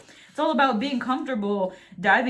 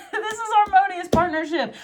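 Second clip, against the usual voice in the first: much higher, laughing through the words; laughing delight; 10 semitones higher, 4 dB louder than usual. Real, not acted. Tarot reader delighted by the partnership card, laughing. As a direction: squeal it with a giddy laugh. Delighted repeat of the card's meaning.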